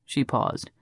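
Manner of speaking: usual speaking voice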